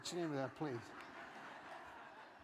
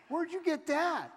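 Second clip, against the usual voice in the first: high-pitched incredulous question; comic incredulity, pitched way up; mock disbelief; 8 semitones higher than usual. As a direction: react as if baffled by the idea. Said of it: "And I go".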